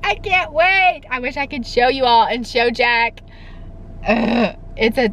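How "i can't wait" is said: giddy excitement, pitch climbing; bubbling over with excitement; excited, higher. Real, not acted. Telling viewers she's getting the dress, excited. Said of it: "I'm super excited".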